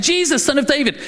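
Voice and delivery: the beggar crying out again, mild shout; calling out. Acted. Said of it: "Bartimaeus shouts out".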